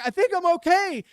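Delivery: high, loud, frantic answer; rattled reply. Retold or imitated. Friend answering after crashing through ceiling, loud and rattled. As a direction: shout back, still shocked by the fall.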